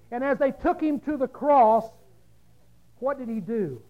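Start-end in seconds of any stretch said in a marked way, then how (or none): none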